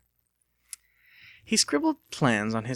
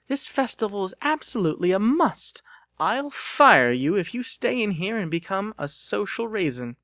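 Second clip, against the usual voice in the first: higher, louder mock-stern insistence; insistent boss; 8 semitones higher, 4 dB louder than usual. Missus Bittersworth insisting Lucas join the festival. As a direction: playfully threaten to fire him.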